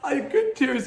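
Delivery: high-pitched imitation of a crying son; retold tearful child. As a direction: voice a tearful little boy, reassuring his dad, pitched well up. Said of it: "he began to just get cry".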